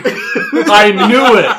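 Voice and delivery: high, slow, loud laughing; reacting to the real quote, laughing. Real, not acted. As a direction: drag it out while cracking up.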